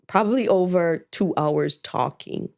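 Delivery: normal talking voice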